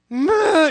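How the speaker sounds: put-on a loud, high frustrated toddler whine. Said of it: "she's frustrated".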